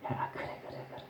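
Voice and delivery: gloating Satan whispering approval; barely audible, voiceless egging-on; sly whisper. Retold or imitated. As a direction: whisper it behind someone's back. Voices Satan grinning behind the person.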